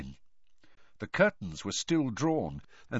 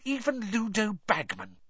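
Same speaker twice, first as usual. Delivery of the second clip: higher-pitched, seething accusation; through gritted teeth; 9 semitones higher than usual. A narrator's reading. Hermione accusing the reporter, seething through gritted teeth. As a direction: accuse her with contained fury. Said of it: "through gritted teeth".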